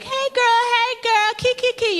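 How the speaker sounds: put-on high, sassy sing-song girl talk; sister girl greeting. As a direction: squeal it like a sassy girlfriend. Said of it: "sister girl language".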